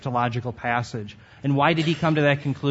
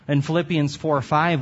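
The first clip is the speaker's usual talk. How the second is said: usual speaking voice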